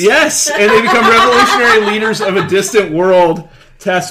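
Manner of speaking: high, loud laughing insistence; excited laughing. Real, not acted. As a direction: push it hard through laughter. Defends pitch, laughing, high.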